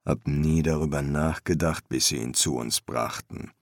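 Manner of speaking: low, soft master's voice; subdued master embalmer; master embalmer, patient. A narrator's reading. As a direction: correct the apprentice low and patiently.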